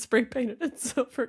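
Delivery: ordinary talk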